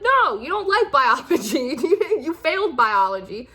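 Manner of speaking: exasperated no, breaking into a laugh; mock scolding. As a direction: object in amused disbelief. Acted scolding of a wayward student.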